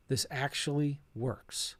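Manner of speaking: hushed and slow, half to himself; quiet awe. Retold or imitated. Investor realizing his first deal paid, hushed awe. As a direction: let it sink in, softly.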